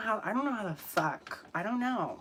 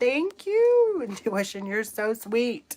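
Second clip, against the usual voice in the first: gushing thanks; 4 semitones higher, 8 dB louder than usual. Real, not acted.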